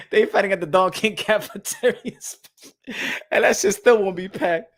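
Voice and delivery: talking through laughter; real laughing. Real, not acted. Commentator joking about Don King cafeteria, laughing as he talks. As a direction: get the joke out while cracking up.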